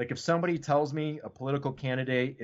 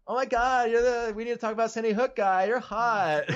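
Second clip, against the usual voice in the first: high-pitched gushing girl imitation; hammed-up starstruck girl voice; mock fangirl; 10 semitones higher, 3 dB louder than usual. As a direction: squeal it like an admiring girl, for laughs. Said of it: "girls don't just come up to you and be like".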